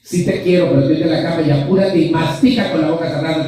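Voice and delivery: high, rushed scolding "Sí te quiero" as a bossy mother; mother scolding her kid while saying she loves him; nagging mother. Retold or imitated. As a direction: rattle off the orders fast and high like a nagging mom. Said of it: "con un pinche tono de voz".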